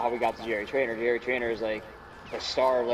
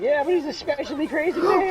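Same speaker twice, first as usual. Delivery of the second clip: high, loud mock alarm; frantic, hammed-up protest; panicked sitcom characters; 5 dB louder than usual. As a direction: exclaim it in pretend panic. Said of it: "They're like".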